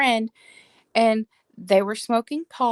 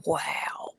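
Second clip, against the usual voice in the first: whispered awe; 5 semitones lower, 8 dB quieter than usual. Retold or imitated. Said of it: "I was like".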